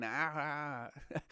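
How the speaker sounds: half-hearted mock scream, "aah"; Ric Flair scream. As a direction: imitate a wrestler's drawn-out scream at half volume. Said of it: "like, the Ric Flair scream".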